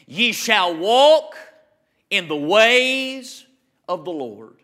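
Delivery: slow, drawn-out verse with pauses; scripture recitation. Acted. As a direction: proclaim it slowly, stretching each phrase.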